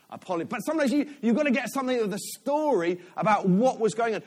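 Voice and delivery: usual speaking voice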